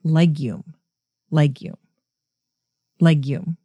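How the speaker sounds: legume said LEG-yoom, stress on the first syllable; "legume" as LEG-yoom, settled and falling; settling on legume. Shown as a demonstration. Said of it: "I think I'm gonna say".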